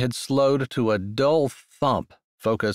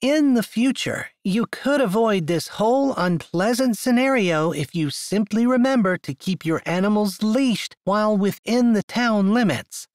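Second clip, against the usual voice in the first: condescending; 9 semitones higher than usual. Acted. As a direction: talk down to him, prim and high. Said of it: "to sound condescending".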